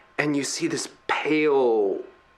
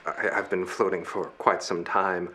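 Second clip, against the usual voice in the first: weak rescued castaway; 7 semitones lower, 6 dB quieter than usual. Acted. Rescued body answers the crew.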